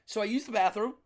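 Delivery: ordinary talk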